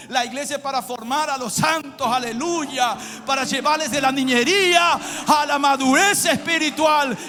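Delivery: loud, high, impassioned preacher shout; full-force preaching. Real, not acted. Pastor telling his church whom Christ comes for, full-force preaching. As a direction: thunder it out to the congregation, stretching key words.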